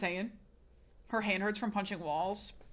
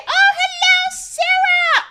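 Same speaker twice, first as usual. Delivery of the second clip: much higher, louder, sing-song greeting; squeaky pet voice; 16 dB louder, much slower than usual. Acted. Streamer greeting Sarah, squeaky high sing-song voice. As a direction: coo at Sarah like a baby.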